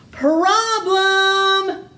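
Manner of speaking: high, loud witch screech. Acted. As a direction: screech the word out in dismay. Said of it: "Screeched Gritch".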